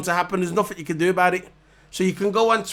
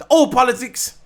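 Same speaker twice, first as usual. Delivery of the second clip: emphatic outburst; 6 semitones higher, 5 dB louder than usual. Real, not acted. Repeating his rant point.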